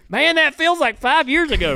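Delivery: hammed-up high driver voice; mock Sam. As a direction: tease him with his own excuse. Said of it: "you remember hitting the wall".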